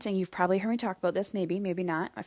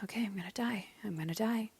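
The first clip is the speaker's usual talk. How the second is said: breathy whisper; 9 dB quieter, faster than usual. Retold or imitated. Crying silently on the floor, whispering to herself. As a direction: whisper on the exhale. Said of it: "inhaling and exhaling and going".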